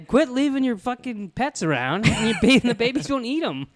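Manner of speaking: mock scold. Joking to the friend about the baby, mock scolding voice.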